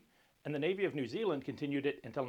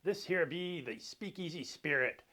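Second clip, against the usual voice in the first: hammed-up pirate captain's greeting; 2 semitones lower than usual. Host playing pirate welcomes viewers aboard the vessel, pirate voice. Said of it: "I can't keep talking like that".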